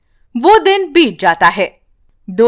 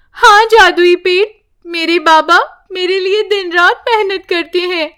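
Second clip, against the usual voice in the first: put-on higher child speaking earnestly; little girl's voice; 6 semitones higher than usual. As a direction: tell the tree about your father. Child talks to tree.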